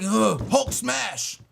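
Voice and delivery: slow caveman grunt, drawn-out words. Acted. Web developer mocking blunt online replies, slow caveman voice. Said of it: "a caveman".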